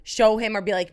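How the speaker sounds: ordinary talk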